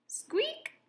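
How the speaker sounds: a very high tiny squeak; rabbit squeak. A narrator's reading. Rabbit greets the new cat with a high squeak. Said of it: "said a rabbit".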